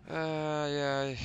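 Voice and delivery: high, loud scared cries; frightened. Real, not acted. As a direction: yelp in fear.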